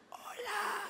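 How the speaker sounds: a timid squeak instead of depth; failed deep hello. Shown as a demonstration. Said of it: "Uy, sí".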